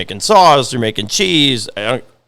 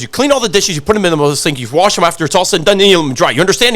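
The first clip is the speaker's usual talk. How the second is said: raised, driving list of instructions; grandfather laying down orders; 6 semitones higher, 4 dB louder, faster than usual. Retold or imitated. Grandfather to delivery guy about washing dishes, ordering. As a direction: imitate a boss drilling in the routine. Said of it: "He goes".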